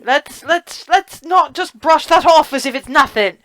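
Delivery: keen and pressing, don't let it slide; excited. Real, not acted. Insists on not skipping ahead.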